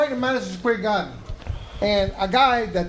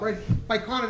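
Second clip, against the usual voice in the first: ordinary talk, nothing marked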